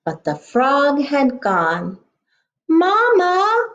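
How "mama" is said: high, loud call for mama; lost duckling calling mama. Acted. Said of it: "called Daisy".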